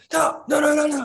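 high excited mock yell of no; hammed-up group yell; retold yelling. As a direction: mimic a group all yelling no at once. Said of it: "yell at me".

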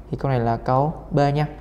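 ordinary talk

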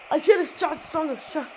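normal talking voice

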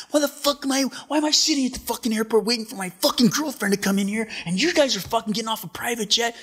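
high, loud imitation of a furious rant; losing it. Pissed-off surfer confronting friends off the private jet, losing it. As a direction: mimic someone exploding in outraged, high-pitched swearing. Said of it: "Just losing it".

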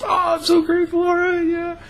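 very high, loud, half-crying squeal of thanks; overwhelmed with relief; tearful joy. Retold or imitated. Overjoyed that he quits.

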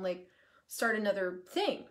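ordinary talk, nothing marked

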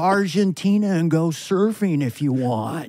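easy, drawling and amused; Owen Wilson. Pretend. A guest on the show, as Owen Wilson, laid-back. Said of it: "It's almost Owen Wilson".

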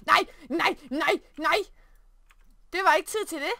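high, loud real dismay; panicked shout. Real, not acted. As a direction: shout in alarm at the Sim. Player panics at game event.

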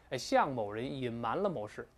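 normal talking voice

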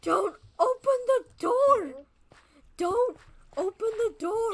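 high, loud, drawn-out warning; alarmed yell. Real, not acted. Player warns Aaron about trapped creeper, alarmed yell. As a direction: yell each word slowly and urgently.

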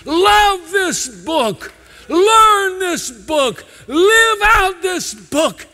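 high, loud, slowed shouted exhortation building; full-force revival sermon climax; preaching at full force. Acted. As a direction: preach it out, pleading with the whole room. Sermon climax calling to congregation.

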